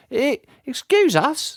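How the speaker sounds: put-on high, slow stammering old lady; hesitant old lady. Aunt nervously asking henchmen to move. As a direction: stumble politely past them.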